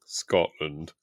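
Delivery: put-on Scotland in a slow, low Scottish accent; mock Scottish accent. Mimicking her co-host's Scottish accent, slow and low. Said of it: "that was worse".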